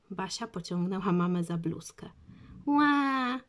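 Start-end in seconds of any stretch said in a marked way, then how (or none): 2.7-3.4 put-on loud high baby howl; baby bawling. A narrator's reading. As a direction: bawl like a furious toddler. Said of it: "dar się Franek".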